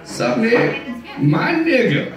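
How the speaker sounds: high, breathy put-on cool voice; Denzel Washington impression. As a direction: lay on the cool swagger. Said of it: "my best Denzel Washington impersonation".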